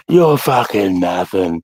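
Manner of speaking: slow, drawn-out mocking jeer; mock drunken taunt. Acts out drunk recruit's taunt.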